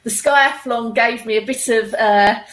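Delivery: ordinary talk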